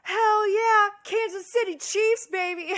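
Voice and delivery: high, loud fan yell; screamed cheer. Retold or imitated. Neighbor cheering for the Chiefs across the courtyard, screaming. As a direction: whoop it out like a proud fan. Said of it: "screaming across the courtyard at each other".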